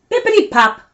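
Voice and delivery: high, bright pop; playful sound effect; oil can pop. Acted. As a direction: squeeze out a pop.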